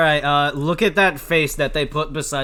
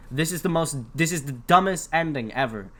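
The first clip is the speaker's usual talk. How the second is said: ordinary talk, nothing marked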